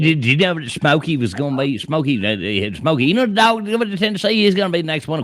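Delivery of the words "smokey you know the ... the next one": mock rant. Imitating Bistar's Tennessee rant.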